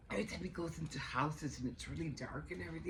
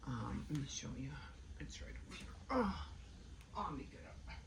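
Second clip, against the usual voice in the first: whispering; 3 semitones lower, 7 dB quieter than usual. Real, not acted. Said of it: "talking low, child sleeping".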